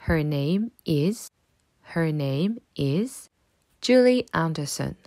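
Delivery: slow, drawn-out "Her name is" with rising end; slow model phrase. Shown as a demonstration. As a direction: spell it out slowly for pupils to repeat. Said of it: "Répète après moi". (phrase modèle lente et montante)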